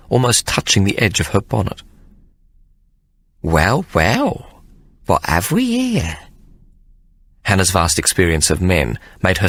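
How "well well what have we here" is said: high, slow, teasing nanny voice; knowing nanny. A narrator's reading. Hannah eyeing Sir Jasper, slow teasing nanny. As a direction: savour the discovery slowly.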